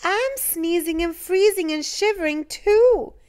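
put-on cold little girl's voice. A narrator's reading. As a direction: speak up like a chilly little girl. Little girl speaking.

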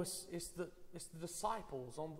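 ordinary talk, nothing marked

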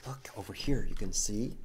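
usual speaking voice

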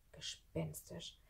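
slow, whispery ghostly word; spooky, drawn-out whisper; eerie hush. A narrator's reading. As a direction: breathe it out slowly and softly. Eerie figure in the narration.

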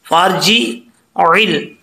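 फर्जिल drawn out slowly, फा joined straight to रा, no long आ; correct slow reading. Shown as a demonstration. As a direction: recite फर्जिल slowly the right way. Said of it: "जिम जेर जी". (सही तरीका: फर्जिल, फा सीधे रा से मिलाकर, धीरे)

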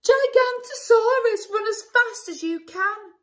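high loud warning shout; loud high cry of fake danger; alarmed shout. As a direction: yell a warning to friends. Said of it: "the cry began".

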